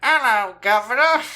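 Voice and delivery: a loud, high comic greeting, joke borrowed from Tim and Eric; Terry Green technique. Shown as a demonstration. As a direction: belt out hello with a laugh. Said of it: "the Terry Green technique".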